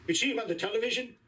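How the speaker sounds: ordinary delivery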